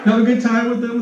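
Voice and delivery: ordinary talk, nothing marked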